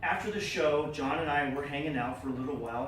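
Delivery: ordinary talk, nothing marked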